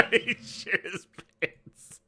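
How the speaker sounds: slow words squeezed through real laughter; cracking up. Real, not acted. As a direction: barely get it out for laughing. Helpless laughter at the man.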